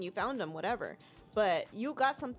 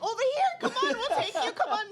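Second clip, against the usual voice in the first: much higher, louder eager taxi driver call; 14 semitones higher, 6 dB louder than usual. Retold or imitated. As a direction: wave them in like an eager cabbie. Taxi driver calling out, retold.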